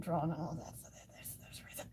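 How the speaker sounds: trailing off into a whisper. Real, not acted. Streamer trailing off to herself, whispering. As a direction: let the words fade to a breathy whisper.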